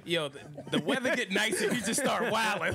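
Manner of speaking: laughing through the words, high pitched; cracking up mid-sentence. Real, not acted. Host cracking up at cohost.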